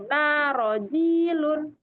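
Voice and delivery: "Narojilun" said very slowly, long vowels drawn out; long vowel model. Shown as a demonstration. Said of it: "tanya aja yang panjang". ("Narojilun" diucapkan pelan dengan vokal panjang)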